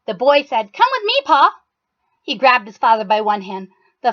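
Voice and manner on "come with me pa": put-on a child pulling his dad along; eager boy. Boy urges father.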